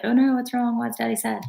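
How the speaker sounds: a hint of a small child asking what's wrong; kids worried. Imitating the kids asking about sad Daddy, lightly high. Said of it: "be like".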